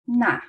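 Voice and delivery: NA high and falling short; fourth tone. Shown as a demonstration. Teacher modelling the other name, falling NA. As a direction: start NA high and drop it. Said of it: "it's a fourth tone".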